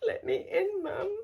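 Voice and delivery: very high, whiny little pet voice; pleading pet. Retold or imitated. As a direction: imitate a pet begging to come in. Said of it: "gave me the little look of".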